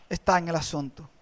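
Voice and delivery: normal talking voice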